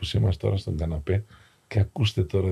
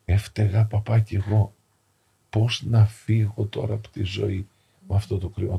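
usual speaking voice

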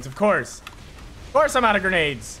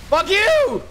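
gloating shout; 10 semitones higher, 3 dB louder than usual. Real, not acted. Gloating kill on enemy.

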